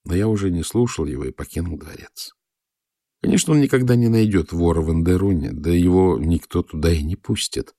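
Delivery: plain reading voice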